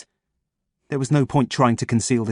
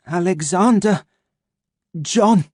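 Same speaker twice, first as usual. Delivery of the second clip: dazed name; 8 semitones higher, 4 dB louder, slower than usual. Acted. Dazed man stating name.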